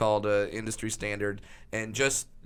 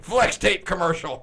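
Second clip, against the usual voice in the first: louder, higher announcer gusto; 5 semitones higher, 6 dB louder than usual. Acted. Commentator saying what he is watching, emphatic mimicry. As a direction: proclaim it like a commentator. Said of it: "He'd be like".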